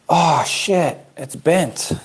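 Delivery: sudden high cry of dismay; real dismay. Real, not acted. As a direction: caught off guard by a ruined print. Finds the print is bent.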